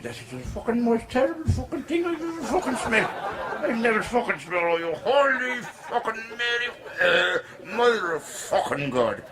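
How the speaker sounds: high, appalled Irish outburst; hammed-up Irish disgust, stretching the oaths; Irish fella. Irish customer reacts to the smell, high Irish outrage. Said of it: "she's got this Irish fella".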